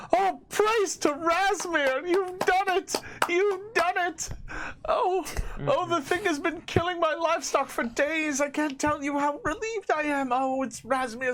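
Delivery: high-pitched, fervent cries of relief; screaming farm woman. Acted. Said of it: "the woman from the farm screams".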